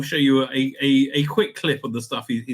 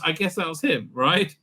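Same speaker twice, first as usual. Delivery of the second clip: ordinary talk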